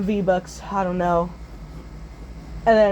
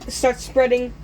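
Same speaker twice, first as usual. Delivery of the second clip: ordinary delivery, nothing marked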